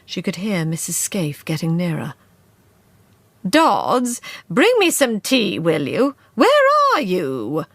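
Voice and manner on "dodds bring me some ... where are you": louder call through the house. A narrator's reading. Missus Scaife calling Dodds for tea. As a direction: summon the maid from below.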